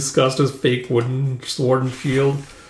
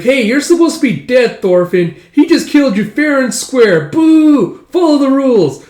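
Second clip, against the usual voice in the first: high, loud playground complaint; loud, high heckling; indignant kid protest; 12 semitones higher, 7 dB louder than usual. Acted. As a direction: yell foul like a cheated child. Kids protest cheating in play battle.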